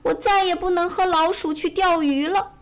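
high, plaintive acted crying voice; tearful. A narrator's reading. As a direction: lament it like a weeping little bear. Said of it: "他哭着说". (哭着诉说)

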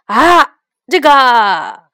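loud startled cry, then stuck for words; dumbstruck. A narrator's reading. Peddler caught in his own contradiction, dumbstruck. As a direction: blurt it out, caught with no answer. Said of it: "小贩张口结舌".

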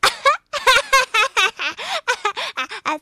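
high, loud laugh fit; breathless laughing. A narrator's reading. Frog laughing himself breathless at his fib, loud laugh fit. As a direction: laugh till you can't breathe. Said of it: "笑得喘不过气儿来了".

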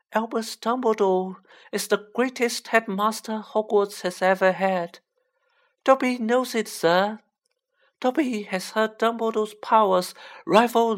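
high-pitched, reverent elf; devoted little creature speaking high and earnest; Dobby, reverent house-elf. Acted.

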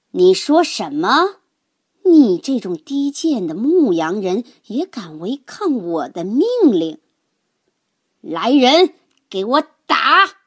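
loud, outraged and contemptuous; lord yelling. A narrator's reading. Lord ordering his men onto a lowly shepherd, yelling. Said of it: "王爷嚷道".

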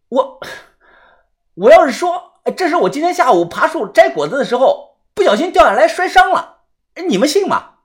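high, uneasy explanation; anxious high-pitched self-defence; pleading excuse. A narrator's reading. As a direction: explain yourself earnestly in a high voice.

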